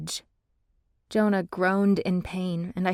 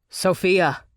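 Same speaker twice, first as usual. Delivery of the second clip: calling out; 6 dB louder than usual. A narrator's reading. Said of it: "Liam called".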